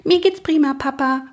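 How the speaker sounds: high little foal voice; joyful foal. A narrator's reading. Overjoyed foal answers father. (fröhliches Fohlen, ganz hoch)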